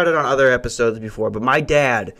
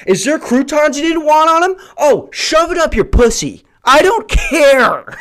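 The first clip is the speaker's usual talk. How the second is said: high, louder exasperated rant; mock furious rant; 11 semitones higher, 4 dB louder than usual. Comic imagining himself as a server snapping at customers, furious. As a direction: rage at the picky diner. Ranting at picky diners.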